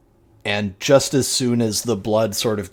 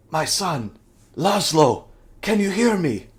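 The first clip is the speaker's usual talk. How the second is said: hopeful and gentle, a little higher; tender hope; 8 semitones higher than usual. Acted. Father gently asking his revived son, hopeful tender question. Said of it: "looks into your eyes and says".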